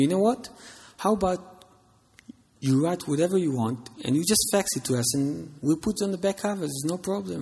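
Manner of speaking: a breezy, casual boss brushing it off; publisher's voice. Retold or imitated. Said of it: "he said to me".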